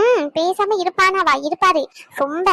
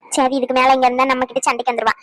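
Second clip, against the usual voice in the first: ordinary talk, nothing marked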